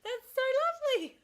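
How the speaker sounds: high, sugary put-on voice; mock sweet reply. Mocking her own overly sweet reply to the receptionist. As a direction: pitch it way up, sickly sweet. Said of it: "I was like".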